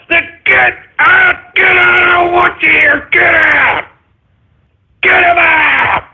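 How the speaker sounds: yelling at them to get out, high and hard; angry shouting. Acted. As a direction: bark them out of the room. Ranting host yells at listeners.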